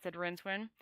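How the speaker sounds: plain reading voice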